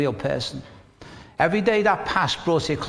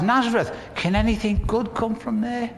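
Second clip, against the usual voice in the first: higher, scoffing voice on "Nazareth"; scornful disbelief; 8 semitones higher than usual. Acted. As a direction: sneer at the town as Nathanael would.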